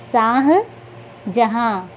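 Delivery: Shahjahan very slowly, split into शाह and जहाँ; Shahjahan stretched, halting between its halves; broken into parts. Shown as a demonstration. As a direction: sound out Shahjahan in two parts with a long pause. Word repeated broken into parts.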